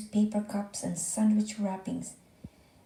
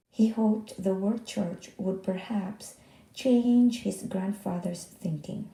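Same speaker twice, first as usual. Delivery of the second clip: ordinary delivery, nothing marked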